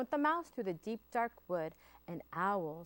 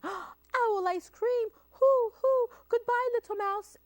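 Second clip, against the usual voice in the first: high, loud wailing owl; terrified owl; 9 dB louder than usual. Acted. The owl, terrified of being eaten, high shrieking hoots. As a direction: shriek it and flee.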